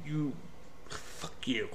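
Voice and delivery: a breathless, hushed fuck you; whispered curse. Real, not acted. Reviewer cursing the movie under his breath, hushed. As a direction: mutter it in disgust under the breath.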